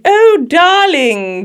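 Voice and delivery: slow, high, drawn-out transatlantic drawl; transatlantic accent. Retold or imitated. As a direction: coo it like a 1940s film star. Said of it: "the transatlantic accent just dripping off the walls".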